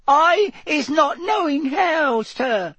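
very high, squeaky little elf; slow, stretched high-pitched protest; Winky. A narrator's reading. The elf pleads ignorance to Mister Diggory, gasping squeak. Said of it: "Winky gasped".

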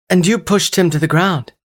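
high, loud indignant woman; outraged female accusation; angry woman. Acted. Cassini scolding angry ex.

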